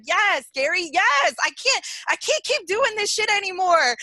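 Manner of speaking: high, loud reenacted cry of agreement; fired-up outburst. Caller re-enacts cheering at the host from her car, fired-up outburst. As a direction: act out crying yes to the radio, at the end of your rope. Acting out her reaction to radio.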